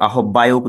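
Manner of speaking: আহ্বায়ক said as a-hob-bayok; hard a-hob-bayok; mistaken pronunciation. Shown as a demonstration. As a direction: sound h and doubled b in আহ্বায়ক. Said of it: "উচ্চারণ করে থাকি".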